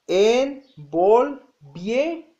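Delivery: ENVOLVIÉRAMOS very slowly, as five separate syllables: en-vol-vié-ra-mos; divided into syllables. Shown as a demonstration. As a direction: break "Envolviéramos" syllable by syllable, pausing after each. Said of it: "se divide en cinco sílabas".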